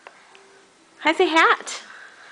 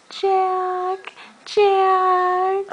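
playful high call; 7 semitones higher than usual. Real, not acted. Calling the toddler playfully.

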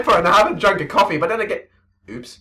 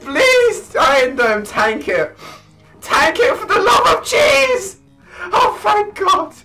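shrieking, laughing and yelling; hysterical laughter; 11 semitones higher than usual. Real, not acted. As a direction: lose it completely. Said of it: "I'm losing it!".

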